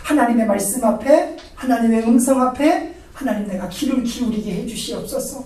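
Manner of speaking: ordinary talk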